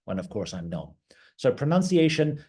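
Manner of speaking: ordinary talk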